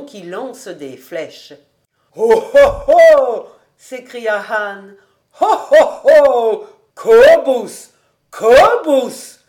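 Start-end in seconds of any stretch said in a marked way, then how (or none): 2.2-9.4 loud astonished cries, swelling with each oh; loud amazed exclamation building to a cry; growing amazement. A narrator's reading. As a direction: shout it out, more and more dumbfounded. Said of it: "sa voix se renflait de plus en plus ébahie".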